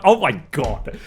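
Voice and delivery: ordinary talk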